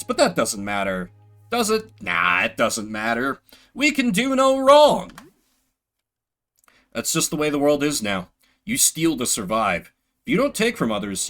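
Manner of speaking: ordinary talk, nothing marked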